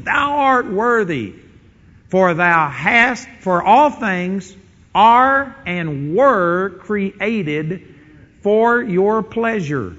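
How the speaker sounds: very slow, drawn out, each phrase held; reverent scripture quote. Acted. Preacher reciting the elders' worship to the congregation, very slow and reverent. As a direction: lay out the heavenly worship phrase by phrase.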